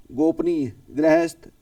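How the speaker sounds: ordinary talk, nothing marked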